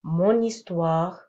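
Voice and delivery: « mon histoire » lent avec n lié; slow liaison. Shown as a demonstration. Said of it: "Par exemple". (liaison lente « mon histoire »)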